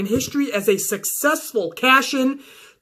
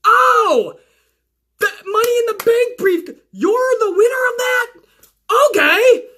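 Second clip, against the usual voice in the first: dawning referee; 11 semitones higher than usual. Retold or imitated. Referee realizes Carmella won the briefcase, high and cracking. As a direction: crack with sudden understanding. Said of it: "understands what is happening".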